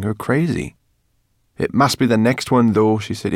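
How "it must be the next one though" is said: louder, higher, pressing tone; impatient little character pushing her point; impatient insistence. A narrator's reading.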